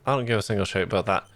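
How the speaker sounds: tired, inconsistent mock Scottish accent; bad Scottish accent. Shown as a demonstration. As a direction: attempt Scottish and let it slip in and out. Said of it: "five minutes of a bad Scottish accent".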